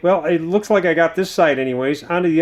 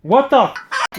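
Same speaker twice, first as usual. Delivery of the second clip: shocked outburst; 8 semitones higher, slower than usual. Real, not acted. Outraged reaction.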